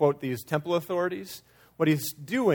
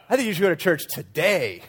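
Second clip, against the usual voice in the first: "today" loud, with its last syllable stretched; stress on TODAY; 3 semitones higher, 4 dB louder than usual. Shown as a demonstration. Preacher playing the emphasis game, stress on "today". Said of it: "change the emphasis of the words".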